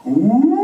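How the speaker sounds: high loud moose call; moose holler. Acted. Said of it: "I give my moose holler".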